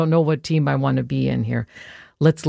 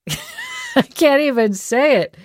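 giggling through the words; can't keep a straight face; laughing; 10 semitones higher than usual. Real, not acted. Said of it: "with a straight face".

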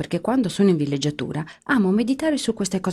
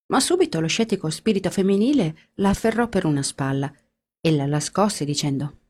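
ordinary delivery

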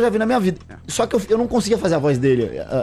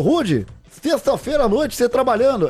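higher, slowed politician caricature; Bolsonaro; 5 semitones higher than usual. Retold or imitated. Guest quotes the politician's reply on the phone, impression. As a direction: put on the politician's voice, teasing in surprise. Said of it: "Aí ele falou".

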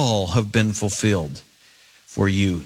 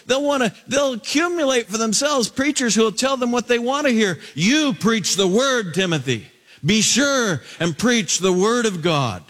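much higher, urgent preaching push; fervent preaching; 11 semitones higher than usual. Acted. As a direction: drive the warning home from the pulpit. Preaching urgently on Paul's charge.

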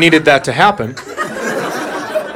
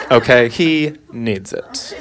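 mock slow insistent mom; 5 semitones lower, much slower than usual. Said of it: "she goes".

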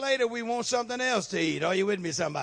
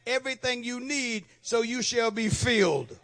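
ordinary talk

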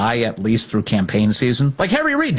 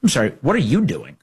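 ordinary talk, nothing marked

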